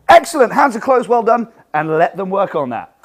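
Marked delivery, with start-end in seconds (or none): 0.1-1.5 high, loud, upbeat praise. Shown as a demonstration. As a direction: cheer them on across the hall. Instructor praising loudly.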